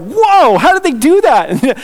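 high, wowed exclamation; mock amazement. Customer watching a packed mattress inflate, playfully amazed. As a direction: leap up high in playful wonder. Acting amazement at expanding bed.

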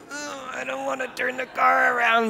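put-on high, whining kid protest; whiny complaint. Pastor whining back at Jesus, high childish protest. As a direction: whine it like a sulky child. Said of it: "And I'm like".

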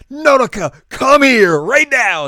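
high, loud, slow summons; a high, loud urgent call to a friend. Retold or imitated. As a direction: yell for Nodoka to come now. Calling Nodoka urgently.

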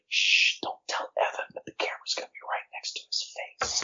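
hushed conspiratorial whisper, breath only. Retold or imitated. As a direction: whisper it like a secret you must keep. Crew secretly warn each other.

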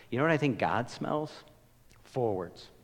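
ordinary talk, nothing marked

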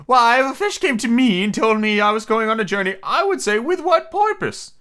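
high, loud turtle voice; Mock Turtle figure. Mock Turtle advising Alice, high character voice. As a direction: declare it way up high as a storybook turtle.

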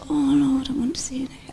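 soft breathy whisper to God; whispered prayer. Retold or imitated. Preacher retelling her own prayer, whispered. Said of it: "I've sometimes said".

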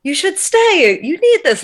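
high pleading inner voice. Retold or imitated. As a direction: coax like the good angel on your shoulder. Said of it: "the other side's like".

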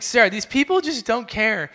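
retold venting to a coworker, fed up; frustrated. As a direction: act out being fed up with people. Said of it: "I was pretty frustrated".

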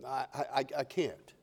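very soft, low, stammering; hesitant little refusal; timid voice. Acted. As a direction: falter meekly, barely audible. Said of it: "this quiet little timid voice".

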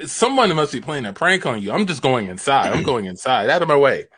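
ordinary talk, nothing marked

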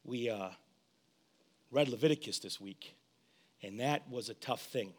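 low, soft radio DJ voice; smooth late night radio host. Real, not acted. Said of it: "this is my, um, late night D J voice".

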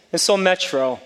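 loud, high, impassioned pulpit exhortation; preaching at full force. Acted. Pastor urging the congregation to trust God, full-force preaching.

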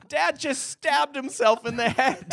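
high-pitched mock horror through laughter; panicked stage outburst. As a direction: retell the actress's wail, nearly laughing. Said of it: "And she goes".

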